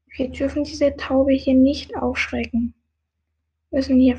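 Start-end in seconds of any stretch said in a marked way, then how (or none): none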